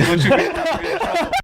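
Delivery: breaking into laughter mid-question; a high, cracking laugh through the words; laughing at the absurdity of it. Real, not acted. Laughing retelling his own retort.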